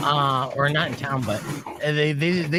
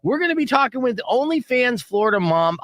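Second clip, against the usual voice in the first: high-pitched, energetic host tease; show promo announcer; 10 semitones higher than usual. Real, not acted. Host teasing the OnlyFans guest after the reset, high announcer pitch. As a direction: sell the upcoming guest.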